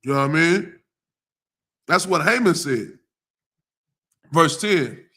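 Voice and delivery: ordinary delivery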